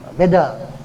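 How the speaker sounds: ordinary talk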